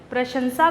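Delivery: प्रशंसा said with a plain s sound, prasansa; प्रशंसा mispronounced as prasansa; wrong pronunciation. Shown as a demonstration. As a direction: demo of the common mistake, प्रशंसा with s instead of sh. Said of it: "जबकि ये गलत उच्चारण है".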